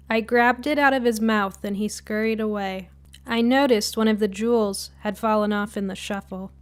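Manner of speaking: ordinary delivery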